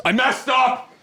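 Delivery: high-pitched, cartoonish confession; distraught employee. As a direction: blurt it out in a high put-on voice. Worker confesses mistake to boss.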